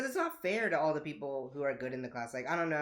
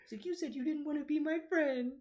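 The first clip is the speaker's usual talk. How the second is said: high, small mock-tearful voice; crying kid; 10 semitones higher than usual. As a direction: imitate a hurt child whimpering accusingly. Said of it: "like, crying".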